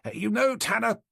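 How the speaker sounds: lightly voiced high-pitched doctor. A narrator's reading. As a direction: raise the pitch for the doctor's reply. Doctor's voice in acted dialogue.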